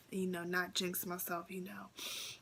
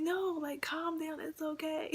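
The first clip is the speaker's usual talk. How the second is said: higher-pitched mock reassurance; reassuring examiner; 8 semitones higher, 4 dB louder than usual. Examiner calming a nervous driver on the road test, reassuring. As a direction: imitate someone soothing a nervous driver, pitched up.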